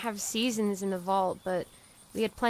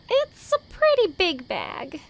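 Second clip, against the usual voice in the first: cheeky defence; 7 semitones higher, 5 dB louder than usual. Acted. Defending her trash collecting.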